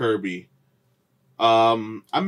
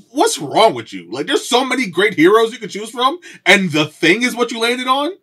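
mock outrage; 11 semitones higher, 3 dB louder than usual. Mock outrage at friend's pick.

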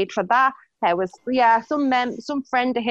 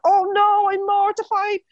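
mock mortified squeal; 9 semitones higher, slower than usual. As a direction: squeal it like a hammed-up drama queen. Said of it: "I was like".

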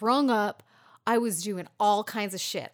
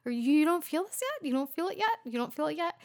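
high, quick, insistent dad voice; pestering dad, pitched up; imitating dad; 8 semitones higher, much faster than usual. Dad voiced pressing her, higher.

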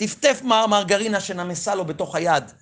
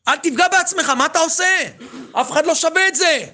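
much higher, pleading cry; begging shout; 9 semitones higher than usual. Retold or imitated. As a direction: plead desperately, voice pitched up. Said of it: "צעקתי אליו, אני מתחנן".

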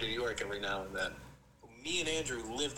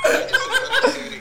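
laughing; 15 dB louder than usual. Real, not acted. Mock self-defense, laughing.

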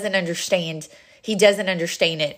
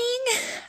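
real laugh; 7 dB quieter than usual. Real, not acted. Laughing at herself.

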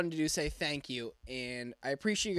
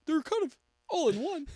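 high put-on family voice; squeaky family impression; 11 semitones higher than usual. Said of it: "me doing your entire family".

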